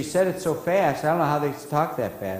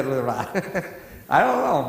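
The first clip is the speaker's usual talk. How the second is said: normal talking voice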